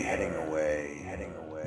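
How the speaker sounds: repeat, fading to nothing; fading echo. Acted. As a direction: let it fade away. Voice fading over magic line.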